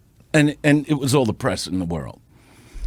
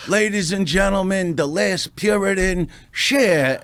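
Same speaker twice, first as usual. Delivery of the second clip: higher, grand announcer call; announcer intro; 6 semitones higher than usual. Retold or imitated. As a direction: introduce the star like an emcee. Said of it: "now I go".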